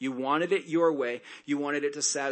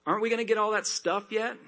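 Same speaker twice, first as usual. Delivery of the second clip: higher, impatient whine; whiny complainer; 7 semitones higher, faster than usual. Retold or imitated. Whining people voiced.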